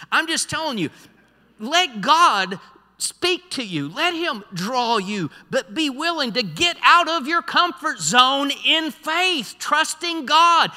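high, loud preaching building to a climax; impassioned preaching. Acted. Preacher urging the congregation out of the boat, building fervor. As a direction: drive it home, stretching the words.

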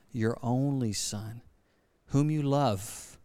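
slow and weighty, leaning on "only" and "love"; added emphasis. Shown as a demonstration. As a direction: drag "your only son, whom you love" out word by word so it sinks in. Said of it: "put a little more emphasis on it".

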